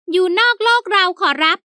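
high, eager fawning servant. A narrator's reading. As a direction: pitch it up as the polite old servant. Servant Haew answers.

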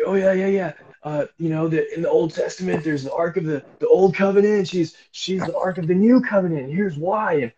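high, loud, eager explaining; eager rambling. Retold or imitated. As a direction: ramble on excitedly like an overkeen explainer. Acts out his own eager rambling.

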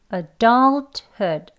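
adulthood drawn out syllable by syllable; slow. Shown as a demonstration. Teacher modelling the word slowly for learners. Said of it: "천천히 해볼게요".

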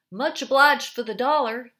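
loud call from twenty feet away. A narrator's reading. Park bencher calls after the departing narrator, loud. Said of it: "the park bencher called to me".

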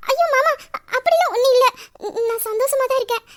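wife protesting to her apologizing husband, flustered. Acted. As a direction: wave away his apology in a rush.

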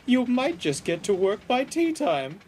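very high, teasing car voice; cheeky. A narrator's reading. Caroline overtaking George on his way to work, cheeky. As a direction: tease in a high, chirpy toot. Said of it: "tooted Caroline cheekily".

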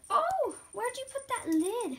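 high-pitched questioning of a pet; pet talk. Real, not acted. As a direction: coo a question at your ferret. Talking to her ferret.

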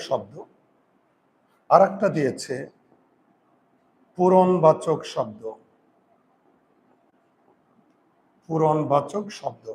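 slow, each term said twice for writing; teacher dictating terms to pupils; slow dictation. Shown as a demonstration. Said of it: "লিখো".

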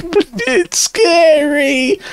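very high, drawn-out mock scared voice; imitating Hadrian. Quoting someone frightened by a nature show, mock-scared squeal. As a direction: squeal it slowly in a tiny high voice. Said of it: "and he was like".

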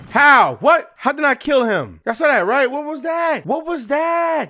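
very high, loud disbelief; real high-pitched yelps of disbelief; shocked outcry. Real, not acted. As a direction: shout in outraged astonishment. Stunned at failed finishing blow.

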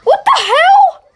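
high, loud shocked squeal; shocked shriek. Real, not acted. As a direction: shriek at what you just saw. Shocked reaction to video.

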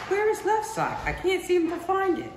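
a high-pitched mom hunting the house; mom's voice. Retold or imitated. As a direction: call out like a searching mother.

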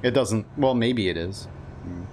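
usual speaking voice

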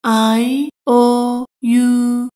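"I owe you" said very slowly, word by word; very slow, careful. Shown as a demonstration. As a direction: drag it out for learners. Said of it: "teacher repeats slowly". (خیلی آهسته و شمرده)

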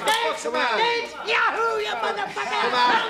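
high, loud taunting screams; screaming taunts at the man as friends hold him back; raging yell. Acted. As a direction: yell and jeer while they drag you off. Raging while being held back.

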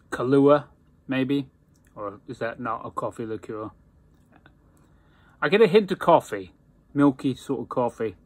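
talking around pipe stem; pipe in mouth. Real, not acted. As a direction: lips clamped on pipe. Seen 0: Pipe stem held in mouth throughout while speaking, face clearly visible.

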